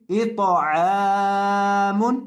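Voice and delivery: अश्तातन read very slowly with each syllable held; drawn-out reading. Shown as a demonstration. Teacher showing students the whole word read slowly. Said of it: "teacher reads the whole word".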